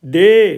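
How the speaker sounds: "डे" drawn out on a long ए; teacher demonstrating the long ए in "डे"; slightly lengthened ए. Shown as a demonstration. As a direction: hold the vowel of "डे", stressed, then falling. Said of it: "ये थोड़ा सा लंबा उच्चारण".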